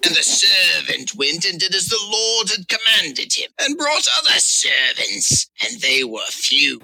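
high, slow pirate declamation; hammed-up pirate scripture reading. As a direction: read it as a drawn-out, high-pitched pirate. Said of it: "I'm trying to place this pirate".

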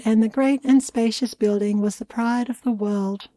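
ordinary delivery

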